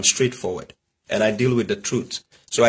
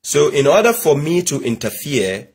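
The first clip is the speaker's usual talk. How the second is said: normal talking voice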